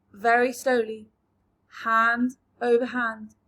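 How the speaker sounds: plain reading voice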